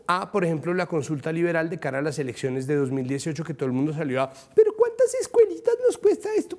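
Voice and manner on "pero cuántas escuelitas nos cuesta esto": very high-pitched caricature of a complainer; mock public outcry. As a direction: squeal it like an exaggerated indignant critic. Mimics outraged public's complaint.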